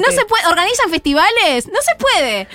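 high, playful reprimand; mock scolding. Columnist jokingly forbids parents' festivals, mock scolding. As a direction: lay down the law in a high mock voice.

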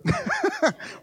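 a high, soft squealing laugh; delighted squeal. Real, not acted. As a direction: squeal like your knees just gave way. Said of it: "my knees just went like this".

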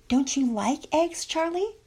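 put-on high, slow coaxing of a toddler. A narrator's reading. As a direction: wheedle sweetly, lifting high at the end. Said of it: "tried to coax him".

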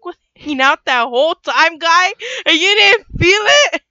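incredulous, rising to high and loud; hammed-up incredulous outburst; loud disbelief. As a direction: confront him in mock astonishment, building to a cry. Acts out disbelief at cartoon character.

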